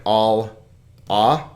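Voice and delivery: all, then ah after a gap; wrongly divided syllables. Shown as a demonstration. Teacher showing another division, all and ah said apart. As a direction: put the l on all, leaving ah alone. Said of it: "If you had pronounced this one like".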